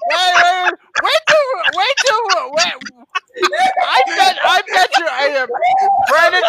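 high, laughing, stumbling over words; real helpless laughter. Real, not acted. Host betting co-host the gag gets posted, helpless laughter. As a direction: try to talk through a laughing fit.

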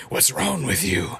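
creepy doll lashing out; slow, rough voice of an angry doll; Percy. Retold or imitated. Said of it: "He's like".

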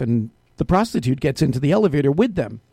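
ordinary talk

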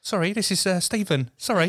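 mock-sheepish, high-pitched intruder voice; apologetic spirit. Podcaster joking as a spirit who wandered in, sheepish and high. Joke: awkward extra spirit introduces himself.

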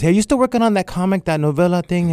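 voicing the mentor up high, eager; mentor's eager voice. Mentor calling the artist about his comic, eager and high. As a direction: lift it like an excited old mentor calling. Mentor's excited phone call.

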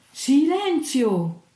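loud, high, drawn-out order; thundering command. A narrator's reading. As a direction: thunder it out to silence the room. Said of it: "tuonò il capitano".

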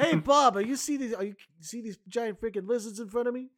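high, loud startled cop. Acted. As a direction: yell it like a panicked beat cop. Voicing the cops seeing dinosaurs.

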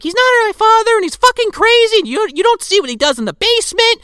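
high, loud frantic protest; mock teenage mouthing off. Retelling a girl mouthing off about her stepfather, high mock outburst. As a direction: protest shrilly, imitating an upset stepdaughter. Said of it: "starts to kinda mouth off to Jerry".

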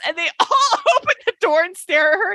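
high-pitched, laughing through the words; real amusement breaking into the speech; laughing while telling. Real, not acted. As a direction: tell it while cracking up. Cracks up at her own story.

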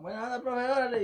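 high, loud mock cheer for the teacher; mock classmates cheering. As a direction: ham up the students' cheeky delight. (burla de alumnos celebrando al profe, en voz alta y aguda)

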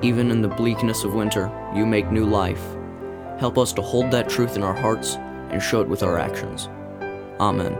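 ordinary talk, nothing marked

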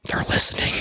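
a hushed, breathy aside, voice barely there; mock whisper. Vlogger jokes to podcast listeners that others overhear, mock whisper. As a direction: whisper it like a conspiracy.

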